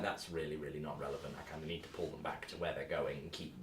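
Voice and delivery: grumbling quietly to yourself; quiet inner grumble. Retold or imitated. GM privately annoyed at stuck players, muttering softly. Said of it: "I'm like".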